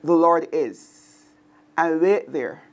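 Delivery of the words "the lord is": slow meditative pause. Shown as a demonstration. Teacher showing listeners how to meditate, slow and lingering. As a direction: say "The Lord is" slowly, then let it hang open. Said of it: "You can simply say".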